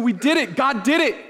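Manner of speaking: high, excited shouts of praise; rejoicing. Retold or imitated. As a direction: cheer like a freed people. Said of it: "they're saying".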